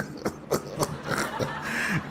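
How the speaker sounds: airy high giggle without voice; high breathy laugh. Real, not acted. Wheezing high giggle at the clip.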